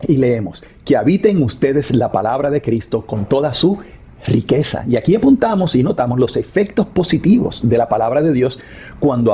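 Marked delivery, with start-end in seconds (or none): 0.9-4.8 pause, then "riqueza" stressed with a rise. Shown as a demonstration. Teacher ending the verse demo, a pause before "riqueza". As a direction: hold back, then land on "riqueza". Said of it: "enfatizamos esa palabra, riqueza".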